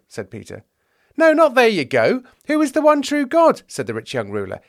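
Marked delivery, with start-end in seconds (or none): 1.2-3.6 high, loud exasperation; exasperated protest. Acted. Young ruler presses disciples for a real answer, exasperated. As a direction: push back hard, pitch soaring.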